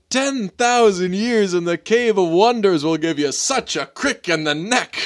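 high, theatrical genie voice; Robin Williams genie. Retold or imitated. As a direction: boom it out like a freed genie. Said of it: "he lets out, like, a big yell and he's like".

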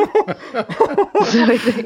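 real laughter, words stretched slow; giggling through the words; amused, laughing too hard to go on. Real, not acted. Laughing at dad's swimming story.

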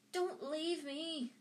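high, drawn-out plea; pleading. A narrator's reading. Neville begs not to be left.

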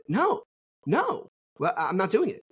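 high-pitched, imitated refusal; retold standing-your-ground protest; defiant refusal. Acted refusal in retold argument.

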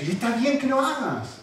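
usual speaking voice

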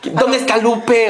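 hammed-up angry caller; outraged customer. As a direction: spell out each word like a furious client. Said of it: "así súper enojado".

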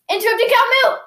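rushed, high-pitched joke punchline; imitated kid rushing the joke; really fast. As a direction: blurt it all in one breath. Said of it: "said it really fast".

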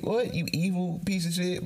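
high, shocked disbelief; shocked outrage. Retold or imitated. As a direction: gasp it out in outrage. Mock outrage at a kid.

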